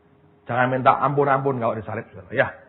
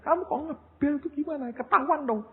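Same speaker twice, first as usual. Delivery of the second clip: mock angry. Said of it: "Saya sampai ngamuk sama istri".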